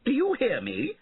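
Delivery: very high indignant yell; shrieked, outraged question; shouted back. A narrator's reading. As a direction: demand an answer in a high shout. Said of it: "shouted back Sir Felton".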